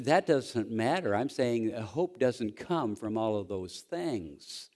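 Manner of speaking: ordinary talk, nothing marked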